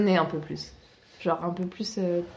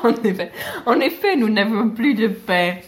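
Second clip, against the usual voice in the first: laughing; 5 dB louder than usual. Real, not acted. Repeating line with laughter.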